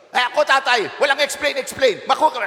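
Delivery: high-pitched, exasperated parental outburst; irritated parent. Acted. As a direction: act out a parent cutting off all explanation. Acting parent refusing to explain.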